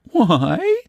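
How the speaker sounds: high, wide-eyed innocent voice; childlike innocent voice. Acted. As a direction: sound puzzled and helpless. Luella innocent surprise.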